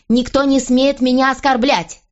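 high-pitched, heated counter; indignant outburst. A narrator's reading. Queen answers the king's warning, indignant outburst.

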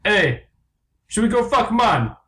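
high mock Jamaican bird; unconvincing Jamaican accent. Jamaican bird propositions another, high mock Jamaican accent.

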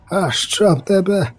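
storyteller's drawn-out yelled curse; narrated yell. A narrator's reading. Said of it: "закричав мишавур".

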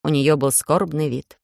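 plain reading voice

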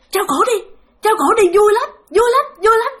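high, sing-out goading chant; gleeful taunting spirits. Retold or imitated. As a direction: egg the victim on in a high voice. Spirits gleefully urging hanging, retold.

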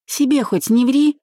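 high, mocking retort; stinging jibe. A narrator's reading. Bride needling her groom, high mocking jibe. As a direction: jab at him with a lifted voice. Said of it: "хохотнув, уколола Марина".

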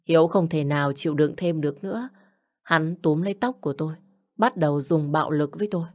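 usual speaking voice

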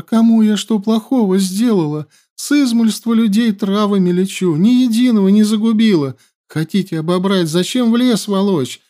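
put-on high, pleading wail of a frightened girl; plaintive lament. A narrator's reading. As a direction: whine and beg pitifully. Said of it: "жалобным голоском причитала". (жалобный причитающий голосок)

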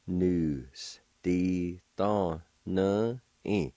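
"Nu. S. Di. Tho. Nu. I." slow, separated; syllable by syllable. Shown as a demonstration. Teacher pronouncing word number two for learners, slowly in syllables. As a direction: leave a gap after every syllable. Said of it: "now I'll pronounce these words".

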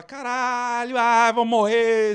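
high, drawn-out mock panic scream; grito de pânico. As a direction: scream it like you're about to crash. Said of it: "você grita só". (grito de pânico encenado)

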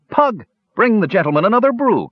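high-pitched hearty order across the tavern; calling out. A narrator's reading. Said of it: "called the dwarf".

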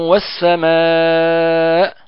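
والسماء drawn out very slowly with a nasal hum; recited with ghunna. Shown as a demonstration. Teacher recites the verse slowly for students. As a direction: chant والسماء long and nasal. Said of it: "إذن أعيد".

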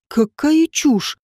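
slow, drawn-out scornful exclamation; angry dismissal. A narrator's reading. Родион dismissing Женька's story, angrily. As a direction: stretch the words out with contempt. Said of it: "зло сказал Родион".

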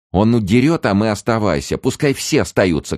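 loud, high hysterical protest; angry shouting. A narrator's reading. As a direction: cry out in outrage at being left behind. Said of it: "кричала она".

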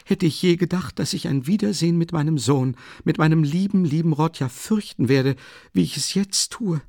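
hohe, aufgeregte Stimme einer ängstlichen Mutter; besorgte Mutter. A narrator's reading. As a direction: eine nervöse alte Mutter, etwas lauter. Mother figure, high voice. (Mutter sorgt sich um den Sohn, hoch und aufgeregt)

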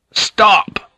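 high, loud commanding shout; explosive order. A narrator's reading. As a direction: halt everyone with one shout. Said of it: "Blackthorn shouted in Japanese".

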